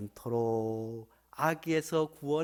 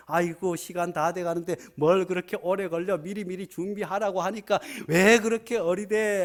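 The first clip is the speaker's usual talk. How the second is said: spouse scolding; 5 semitones higher, 7 dB louder than usual. Retold or imitated. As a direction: play the impatient husband snapping from the car. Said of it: "하면서".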